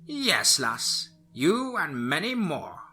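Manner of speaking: high cackling crone, slow; old witch voice. Acted. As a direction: drawl it like a gloating old witch. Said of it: "Lucinda responded".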